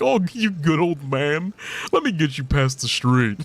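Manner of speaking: mock helper fussing over a senile man; lightly voiced condescending carer; patronizing old-man talk. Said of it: "making me sound like I'm senile".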